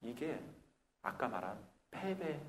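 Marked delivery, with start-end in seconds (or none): none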